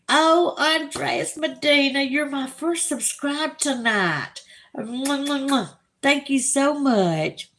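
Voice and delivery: high, slow and gushing with kisses; gushing thanks. Real, not acted. Thanking first subscriber of the night, high and gushing. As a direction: gush over a new subscriber.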